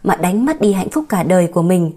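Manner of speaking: plain reading voice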